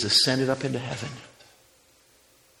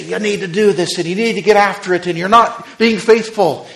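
mock scolding; 6 semitones higher, 11 dB louder than usual.

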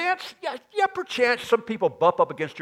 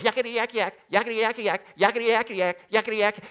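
raised, chattering little demon voice, lightly put on; 6 semitones higher, faster than usual. Preacher voicing a little demon chattering at Jesus, mock yammering. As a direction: rattle it off like a pesky imp. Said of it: "this little demon comes and goes".

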